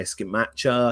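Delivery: "Scamacca" with a "ch" sound, ska-MAT-cha; variant pronunciation. Shown as a demonstration. Host running through name variants, "Scamacca" said as ska-MAT-cha. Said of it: "however you want to pronounce his name".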